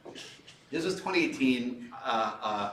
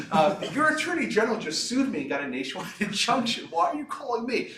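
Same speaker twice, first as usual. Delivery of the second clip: laughing retort; 9 semitones higher, 4 dB louder than usual. Retold or imitated. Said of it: "I'm like".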